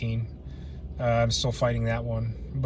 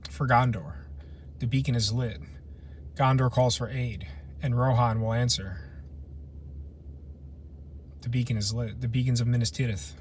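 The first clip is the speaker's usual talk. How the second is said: hushed, slightly lowered epic line; understated movie quote; 3 semitones lower, 7 dB quieter than usual. Acted. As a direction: quote the film softly, half to yourself. Quoting Lord of the Rings lines.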